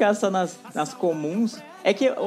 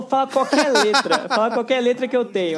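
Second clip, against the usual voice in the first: louder, higher mock boast; mocking quote; 5 semitones higher, 5 dB louder than usual. Host teasing the Pará guest with her own words. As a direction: tease her by parroting her boast. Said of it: "ela falou".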